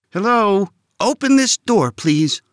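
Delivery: put-on boy shouting at the door. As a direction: call out loud and high. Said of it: "Alvin shouted".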